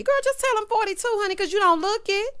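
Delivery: high, loud hyped-up cheering; excited hype. Real, not acted. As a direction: cheer her on at full volume. Excited advice to cast member.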